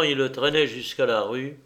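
usual speaking voice

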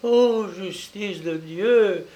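high, slow anguished exclamation; heartrending cry. A narrator's reading. Princess appealing to God's justice, heartrending cry. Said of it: "s'écria la princesse d'une voix déchirante".

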